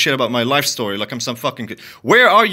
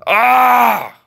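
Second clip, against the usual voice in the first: frustrated cry; 8 semitones higher, 7 dB louder than usual. Real, not acted. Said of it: "I'm fucking pissed".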